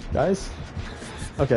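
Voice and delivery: ordinary delivery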